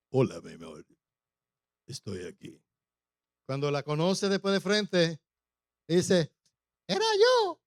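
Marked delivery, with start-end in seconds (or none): 0.1-2.6 very low, soft mock-suave voice; changed seducer voice. Suitor sending his love an audio, changed seducer voice. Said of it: "cambia la voz y dice". 6.9-7.6 high, loud comic reveal; squeaky real voice. Acted. Suitor meeting her face to face, squeaky real voice.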